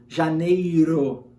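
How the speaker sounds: "janeiro" drawn out very slowly, American style; American try at janeiro. Retold or imitated. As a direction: stretch "janeiro" like an American learner. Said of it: "mais difícil a pronúncia". ("janeiro" bem arrastado, à americana)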